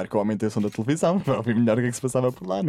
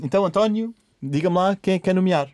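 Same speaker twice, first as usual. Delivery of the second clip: Cristina; 6 semitones higher, 3 dB louder than usual. Retold or imitated. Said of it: "A Cristina diz".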